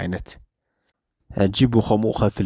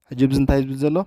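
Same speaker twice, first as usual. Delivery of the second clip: ordinary talk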